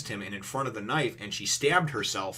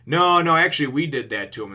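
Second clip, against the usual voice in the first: comic admission; 5 semitones higher, 9 dB louder than usual. Retold or imitated. Sanji's comic confession.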